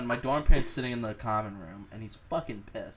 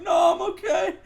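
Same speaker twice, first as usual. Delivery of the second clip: high mock denial; 11 dB louder than usual. Refusing the nurse, mock tough.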